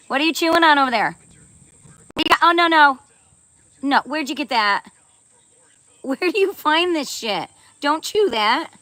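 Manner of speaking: high, loud scolding; alarmed scolding of the dog. Real, not acted. Owner scolding her dog for chewing paper. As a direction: stop the dog chewing, half laughing.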